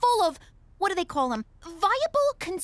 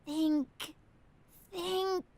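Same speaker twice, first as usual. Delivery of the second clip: muttering to herself; 4 semitones lower, 8 dB quieter, much slower than usual. Real, not acted. Muttering to herself, thinking hard.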